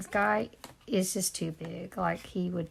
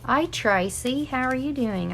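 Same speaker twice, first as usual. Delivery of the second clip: Australian-accented greeting, higher and louder; 6 semitones higher, 5 dB louder than usual. Real, not acted. Crafter greets viewer Tracy mid-stream, Australian accent. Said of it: "I just spoke in an Australian accent".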